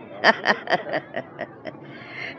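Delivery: low scheming chuckle; villain laugh. Acted. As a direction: laugh low and wicked, pleased with the plan. Rival gloats after her threat.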